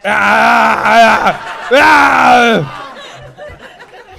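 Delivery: high, loud laughing cries of disbelief; flustered laughing yelp. Real, not acted. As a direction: yelp it out half-laughing, half-shouting. Comic's flustered reaction to answer.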